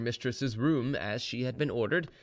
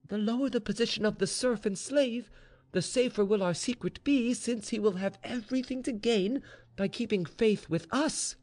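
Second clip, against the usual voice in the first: high-pitched insistent servant voice; earnest maid, persuasive; 10 semitones higher than usual. Acted. Maid persuades mistress to trust a serf, insistent. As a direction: argue the case eagerly. Maid Anushka argues for her plan.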